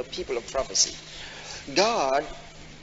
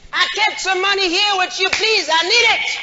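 much higher, louder pleading yell at the bank; mock yell; 6 dB louder than usual. Congregant yelling at the bank director for their money. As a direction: shout up to the manager for your money. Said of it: "did you yell out to the managing director".

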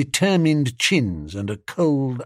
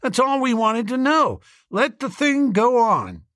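high-pitched hearty approval; 11 semitones higher than usual. A narrator's reading. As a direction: settle it heartily, pitched right up. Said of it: "said the chappie heartily".

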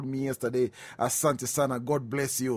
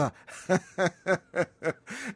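laugh; 5 semitones higher than usual. Real, not acted. Laughs at own remark.

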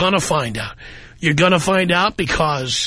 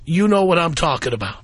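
ordinary talk

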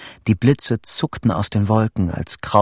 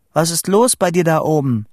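high, raised question; calling up; 7 semitones higher, 3 dB louder than usual. A narrator's reading. Vito asking his friend up on the rock, calling up. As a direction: call up to someone far above.

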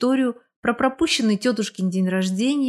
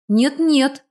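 higher, emphatic refusal; flat refusal; 6 semitones higher, 4 dB louder than usual. A narrator's reading. Woman declining her friend's invitation, flat refusal. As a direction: refuse firmly and at once. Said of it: "наотрез отказалась я".

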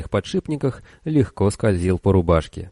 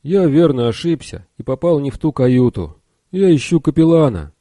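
higher, louder anxious private; flustered recruit apologizing, a bit louder; nervous young soldier; 7 semitones higher, 4 dB louder than usual. Acted. Bill apologizes to officer.

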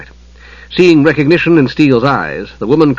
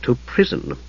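softly finished with a frown; 8 dB quieter than usual. A narrator's reading. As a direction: finish the sentence pointedly, quietly. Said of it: "concluded with a frown for the Kender".

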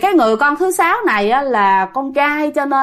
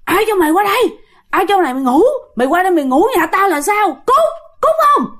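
high, snarling ghost mother, spitting the words; creepy ghost voice; 8 semitones higher, 3 dB louder than usual. Retold or imitated. Ghost mother snarling at the man in her bed, high and menacing. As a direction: growl it out like an angry spirit, climbing to a shriek on the last line. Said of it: "bả nói một cái giọng thấy ghê lắm".

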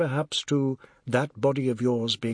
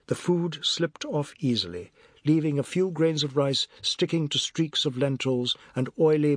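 ordinary delivery, nothing marked

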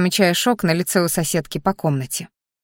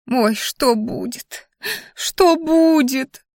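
slow, drawn-out wail climbing much higher; панические причитания; 6 semitones higher, much slower than usual. A narrator's reading. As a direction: wail it in mounting panic. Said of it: "Да перестань паниковать". (протяжно причитая, всё выше и паничнее)

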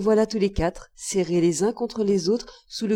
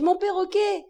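crying out; 11 semitones higher than usual. A narrator's reading. Boy pulling from father's hand, crying out. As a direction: exclaim it high in distress. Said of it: "criai-je".

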